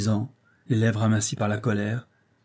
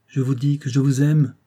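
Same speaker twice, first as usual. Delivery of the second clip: commanding; 5 semitones higher, 6 dB louder than usual. A narrator's reading. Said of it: "Il parlait avec tant d'autorité".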